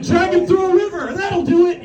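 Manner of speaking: high, exclaimed comic order; a hammed-up doctor calling out a cure; mock confident prescription. Acted doctors' absurd remedy.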